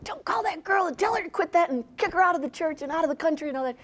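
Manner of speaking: high, fast, hammed-up parent demands; mock outraged parents. Retelling what angry parish parents demanded, high and fast. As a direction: rattle off the parents' outraged wishes.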